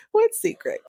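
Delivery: high-pitched playful denial; mock innocence. [name] asked for her secret, mock innocent.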